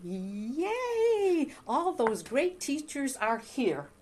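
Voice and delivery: high, loud gleeful squeal; excited squeal. Retold or imitated. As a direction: squeal it out with childlike glee. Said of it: "I'm going".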